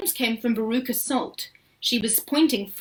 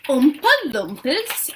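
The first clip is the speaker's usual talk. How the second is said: slow, louder baffled question; puzzled chorus; 6 semitones higher, 7 dB louder than usual. A narrator's reading. Visitors repeating the odd name, astonished. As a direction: say it in disbelief. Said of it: "everyone said at once".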